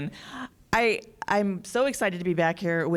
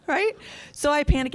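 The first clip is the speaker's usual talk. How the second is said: ordinary delivery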